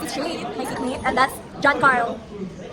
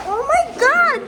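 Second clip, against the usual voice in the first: excited gasp; 12 semitones higher, 5 dB louder than usual. Real, not acted. Sees the sunset.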